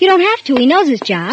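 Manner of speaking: anxious protest. Acted. Wife tries to stop husband.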